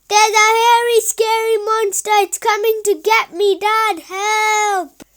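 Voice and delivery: high, loud, fast panicked shouting; scared shout. Acted. Boy pretending to his dad there's a monster, shouting in fake fright. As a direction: shout for help as if the monster's coming. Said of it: "he shouted".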